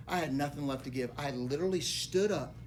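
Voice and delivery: ordinary talk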